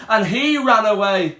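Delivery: usual speaking voice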